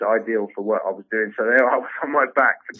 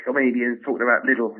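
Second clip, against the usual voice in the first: usual speaking voice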